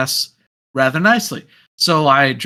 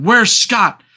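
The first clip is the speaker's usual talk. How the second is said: higher, urgent calling out; intense searching; 5 semitones higher, 4 dB louder than usual. Retold or imitated. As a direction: call round the tables for someone. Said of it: "a level of intensity".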